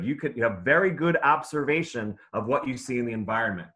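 ordinary talk, nothing marked

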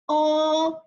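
long 哦 with no pitch movement; first tone. Shown as a demonstration. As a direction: sustain 哦 on one even note. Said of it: "一声呢读哦".

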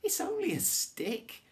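high, loud cry of relieved surprise; amazed gasp. A narrator's reading. Girl realizing the dogs carry a stick, amazed cry. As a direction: exclaim it way up high. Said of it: "she gasped".

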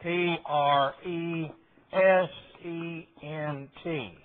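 slow, letter-by-letter spelling of P R E S E N T; spelled out letter by letter. Shown as a demonstration. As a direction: read out each letter of "P R E S E N T" slowly. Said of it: "A, uh, word in the Bible".

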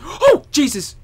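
gasp, then high loud yelp; real startled fright. Real, not acted. Player jump-scared in a horror game, startled yelp.

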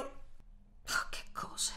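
hushed, breathless whisper; shocked whisper. Acted. Seamstress aghast at the partisan's story, whispering. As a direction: gasp it under your breath.

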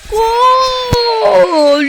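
loud, high GOL yell; goal shout. Real, not acted. Gamer celebrating his goal, shouting. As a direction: scream the goal in triumph.

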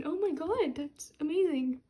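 normal talking voice

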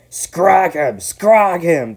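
high, full-force mob yell. A narrator's reading. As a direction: yell it out like a gang on the attack. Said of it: "they yelled".

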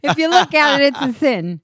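put-on loud, thick Boston accent; hammed-up Boston Puritan. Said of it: "you guys doing a Boston accent".

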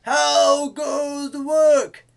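slow, high, very loud holler; farmer shouting. A narrator's reading. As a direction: stretch it into a big shouted call. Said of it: "and he called".

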